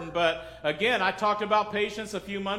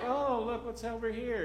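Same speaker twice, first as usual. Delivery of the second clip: softer, mock dawdler pointing something out; imitating idle stroller; 3 semitones higher, 6 dB quieter than usual. Dawdler in a crowded airport, mock leisurely. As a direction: play a carefree stroller, softly. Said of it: "they're just kinda strolling through like".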